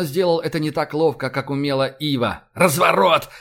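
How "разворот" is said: loud, high, hoarse command; croaked order. A narrator's reading. Admiral ordering a turn in battle, croaking loudly. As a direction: rasp out a shouted order. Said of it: "прохрипел Рашен".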